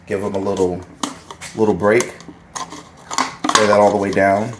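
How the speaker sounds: normal talking voice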